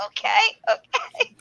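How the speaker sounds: high, drawn-out okays through a laugh; humouring. Retold or imitated. Patient puts up with doctor's lecture, laughingly humouring. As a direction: go along with it, slow and high-pitched.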